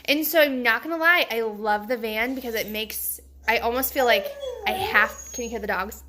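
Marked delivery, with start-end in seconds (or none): none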